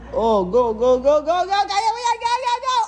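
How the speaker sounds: urgent cheering. Real, not acted. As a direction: keep urging, pitch rising as you go. Urging friend through the shot. (papataas na sigaw ng pag-cheer)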